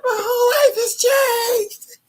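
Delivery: high, loud, slow crying wail. Retold or imitated. New mother telling her mom, crying wail. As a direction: wail it out in tears. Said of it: "I'm like".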